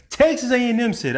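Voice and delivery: Texas said high and punched, pointing the blame at them; emphatic deflection. Retold or imitated. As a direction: throw the claim back at Texas A and M. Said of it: "I ain't said it".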